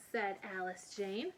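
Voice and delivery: usual speaking voice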